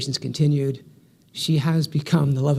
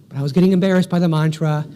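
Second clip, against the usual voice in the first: ordinary talk, nothing marked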